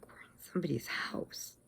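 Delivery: whispered, uneasy realization; hushed aside. Retold or imitated. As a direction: whisper it secretly to a companion. Uneasy aside in strange house.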